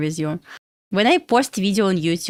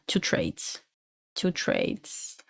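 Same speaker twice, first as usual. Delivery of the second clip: breathy whispered aside repeating "Two trades"; hushed, voiceless echo of "two trades"; 11 dB quieter, much slower than usual. Real, not acted. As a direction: drop to a whisper and repeat it to yourself.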